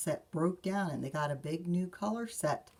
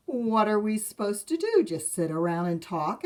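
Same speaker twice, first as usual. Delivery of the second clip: indignant cub; 4 semitones higher, 7 dB louder than usual. Acted. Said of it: "asked Brother".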